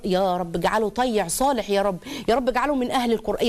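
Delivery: usual speaking voice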